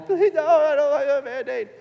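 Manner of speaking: loud, high mock bawling; hammed-up crying child voice; bawling toddler. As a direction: imitate a two-year-old wailing in protest. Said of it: "she's crying, bawling".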